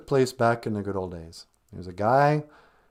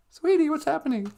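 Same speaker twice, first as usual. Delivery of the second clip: ordinary talk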